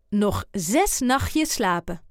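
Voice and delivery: usual speaking voice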